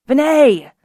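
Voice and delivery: loud, high call of recognition; greeting a friend landing on his dragon, loud and bright; excited exclamation. A narrator's reading. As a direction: call out his name, pleased and surprised. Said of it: "Hanez exclaimed".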